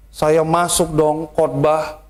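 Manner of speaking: normal talking voice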